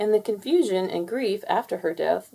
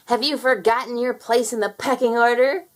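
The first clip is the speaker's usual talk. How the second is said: higher, louder clucking taunt; clucking sneer; 4 semitones higher, 4 dB louder than usual. Acted. Fowler sneers at Henry during the chase, higher and louder. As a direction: cluck it with contempt. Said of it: "he clucked".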